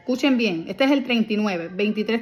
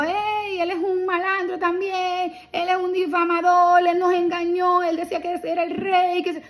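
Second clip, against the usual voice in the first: mocking crowd taunts, pitched higher; burla de la multitud; 6 semitones higher than usual. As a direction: jeer like the crowd on the road. La gente le gritaba a Jesús. (la multitud gritando burlas, voz más aguda)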